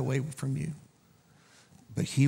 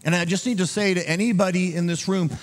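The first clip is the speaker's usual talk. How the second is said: high, urgent pulpit exhortation; impassioned preaching; 9 semitones higher, 4 dB louder than usual. Real, not acted. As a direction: drive the plea home, pitched high and insistent. Preacher exhorts at full force.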